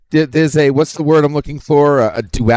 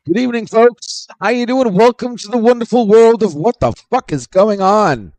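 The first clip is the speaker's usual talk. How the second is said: higher, hammed-up show host; announcer voice; 9 semitones higher than usual. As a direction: welcome them like a showman. Host-style welcome.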